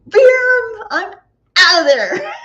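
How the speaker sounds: very loud, high burst breaking into laughter; explosive exclamation. Retold or imitated. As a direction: blurt it like bolting for the exit. Acts out bolting from the room.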